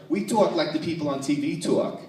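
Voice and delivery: exaggerated up-north accent; Northern accent. Northerner claiming to talk like TV, exaggerated Northern accent.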